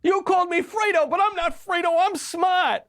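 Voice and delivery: put-on high-pitched, indignant Fredo with an Italian American accent; Fredo impression. Commentator mocking an anchor as Fredo, high-pitched impression. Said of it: "an impression, well, two impressions".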